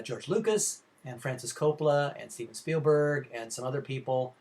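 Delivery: ordinary delivery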